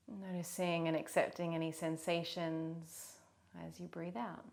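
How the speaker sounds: soft, unhurried meditation voice; guided meditation. Real, not acted. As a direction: guide them gently, leaving long pauses.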